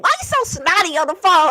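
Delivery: fast, high irritated complaint; aggravated husband. Retold or imitated. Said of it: "he'd be so aggravated".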